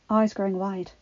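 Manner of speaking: usual speaking voice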